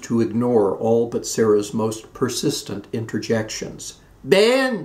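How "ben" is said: high, loud call across the house; raised call for Ben. A narrator's reading. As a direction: call to her husband in the next room. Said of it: "she called again".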